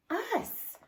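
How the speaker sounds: high, startled little "Us?"; a lightly voiced husband's surprise; surprised husband. Husband surprised by wife, high questioning.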